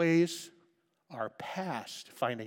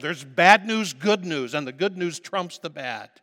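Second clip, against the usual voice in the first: preaching loud; 2 semitones higher, 11 dB louder than usual. Real, not acted. Preacher building to a climax.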